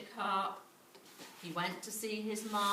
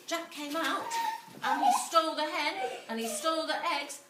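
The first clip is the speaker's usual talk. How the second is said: excited storytelling; 7 semitones higher, 4 dB louder than usual. A narrator's reading. Excited chase narration.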